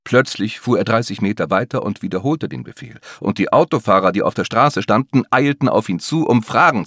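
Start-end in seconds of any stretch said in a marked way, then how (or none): none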